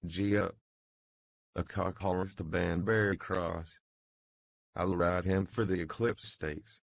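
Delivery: plain reading voice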